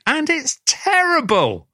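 high, drawn-out protest; emphatic condemnation. Real, not acted. Co-host damning the film that won the award, emphatic high protest.

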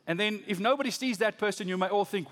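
usual speaking voice

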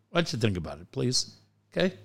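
low, soft, measured prodding; mock-patient questioning. Co-host asking dad again, mock-patient questioning. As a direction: drop low and quiet, press him to reflect.

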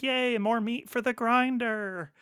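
high, drawn-out cutesy squeal of glee; slow, high-pitched cute little movie creature. Retold or imitated. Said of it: "in this cutesy voice".

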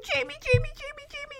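high, eager repeated name; overjoyed dog. Retold or imitated. Chance greets Jamie at the reunion, high and eager. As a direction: squeal the name like an excited dog.

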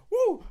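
loud high falsetto whoop; whoop, overcome by the accent; high, smitten woo; swooning woo. Real, not acted. Swooning over Southern accents.

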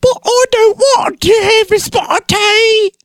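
very high, slow, put-on English schoolboy voice; Daniel Radcliffe impression. Podcast host showing his Harry Potter voice, squeaky and slow. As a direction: camp up a squeaky little English wizard boy. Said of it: "I'm gonna do Daniel Radcliffe".

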